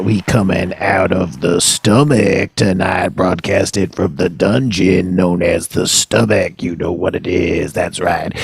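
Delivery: slow, low-pitched radio host patter; radio show intro. Acted. Host welcomes listeners to the spleen's radio show, slow announcer patter.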